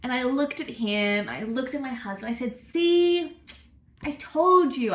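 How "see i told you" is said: high, loud retold vindication; triumphant told-you-so. Wife telling husband she was right, triumphant. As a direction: crow it at your husband, higher and louder.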